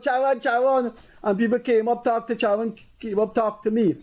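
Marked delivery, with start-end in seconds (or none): 0.0-0.9 a raised call of a name across the square; mild mock calling out. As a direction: call his name out, higher. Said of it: "people were calling out".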